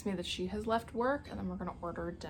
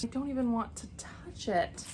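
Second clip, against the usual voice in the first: ordinary talk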